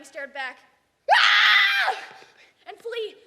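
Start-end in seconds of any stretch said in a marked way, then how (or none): 1.1-2.0 high, loud shriek of fright; terrified scream. Acted. Boy seeing his pox face, loud high scream.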